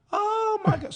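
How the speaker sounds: very high, loud gushing squeal; mock thrilled fan. Older lady meeting the comedian, in a mock thrilled squeal.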